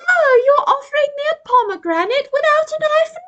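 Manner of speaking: very high, affronted RP British monkey; posh RP British accent. Retold or imitated. Monkey offered a pomegranate, affronted mock RP British. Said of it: "a R P British accent".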